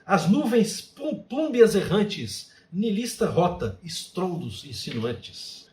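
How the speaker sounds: poetry recitation. Shown as a demonstration. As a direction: declaim the haiku slowly, with weight on each word. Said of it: "são alguns exemplos de haikai". (declamação poética, pausada e enfática)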